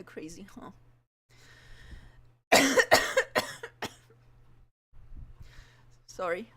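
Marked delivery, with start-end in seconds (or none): 2.5-3.9 harsh dry coughing; coughing fit. Real, not acted. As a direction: cough hard, rough. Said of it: "I'm coughing a lot".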